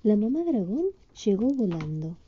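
plain reading voice

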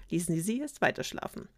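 ordinary delivery, nothing marked

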